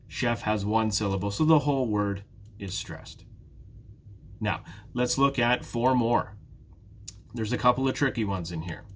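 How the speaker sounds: normal talking voice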